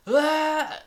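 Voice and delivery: a short, high, loud imitated scream; mock falling yell. Gangster thrown off a roof, loud high mock yell. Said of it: "starting to yell".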